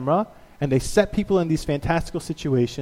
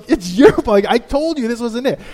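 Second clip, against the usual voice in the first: louder, higher mock accusation; blaming passengers; 7 semitones higher, 4 dB louder than usual. Angry passengers blaming Alan Funt, mock anger. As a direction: point the finger.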